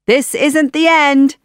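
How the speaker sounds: high, loud villain's threat; defiant shout. A narrator's reading. Advisor being dragged away, defiant shout. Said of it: "Jafar shouted".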